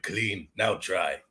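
mock caveman.

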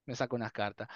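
ordinary talk

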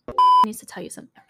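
high, fast, urgent mock plea; squeaky rushed summons; urgent friend. Friend pulling her outside, high fast urgent plea. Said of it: "she's like".

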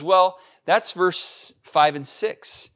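ordinary talk

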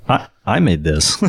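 smug officer. Retold or imitated. Officer steals credit.

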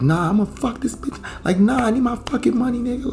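high imitation of a furious woman; angry woman yelling. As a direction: demand the money. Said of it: "she's like".

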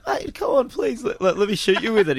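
high, wheedling plea to a mate; pleading. Retold or imitated. Teen begging his mate to let him shoot, high wheedling plea.

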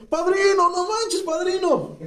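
very high, wailing appeal; hammed-up dismay, pleading up high; mock cry out. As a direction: cry to your sponsor in a high mock panic. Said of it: "Gritó".

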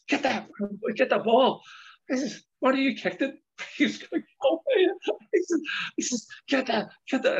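high-pitched mock yell at a player; retold manager's shout. Manager yelling at a player to fetch the ball, high mock shout. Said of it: "And he starts shouting at me".

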